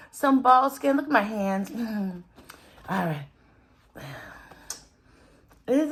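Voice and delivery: ordinary talk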